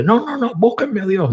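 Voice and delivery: high insistent outburst; mock furious demand. Drowned man in heaven insists on seeing God, mock furious. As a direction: demand to see God at once. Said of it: "llega furioso".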